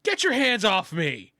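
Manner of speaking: high, slow, forceful order; angry henchman. Acted. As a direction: snap at someone grabbing you. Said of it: "he just goes".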